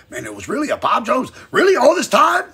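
climbing to a high, loud mock gasp; mock astonishment. Pretending surprise at Bob Jones, hammed-up. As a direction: act out faking shock.

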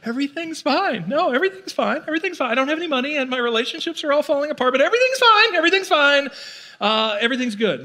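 high, strained "everything's fine" in forced cheer; a mock cheerful fake-calm voice; denial voice. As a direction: squeak out "fine" like someone in denial, climbing to a yelp. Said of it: "Somebody that's like".

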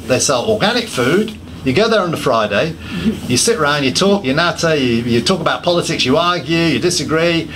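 usual speaking voice

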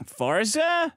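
"Forza" with an OR vowel, rising in a question; puzzled "Forza?" with a rounded first vowel; misheard name. Retold or imitated. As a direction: query "Forza" high, as if unsure you heard it. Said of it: "And she's like, "Franz." Did you say".

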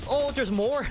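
sudden high alarmed shout; surprised shout. Real, not acted. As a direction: yelp it, caught off guard. More enemies suddenly appear.

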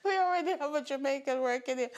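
laughing mimicry of the employer; mocking echo. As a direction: replay the line in disbelief.

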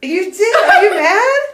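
high, loud questions through laughter; laughing disbelief. Real, not acted. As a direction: squeal it out while cracking up. Friend laughs, asks if mad.

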